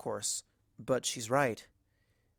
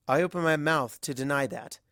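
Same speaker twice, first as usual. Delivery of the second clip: ordinary delivery, nothing marked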